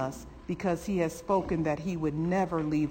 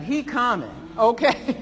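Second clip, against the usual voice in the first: higher, loud preacher's cry; exultant shout; 6 semitones higher, 7 dB louder than usual. Real, not acted. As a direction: call it out to the whole church.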